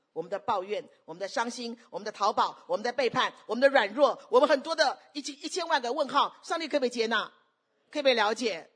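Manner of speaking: loud, insistent sermon questioning; impassioned preaching. Real, not acted. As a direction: pile up the questions with rising force. Preacher builds list of questions.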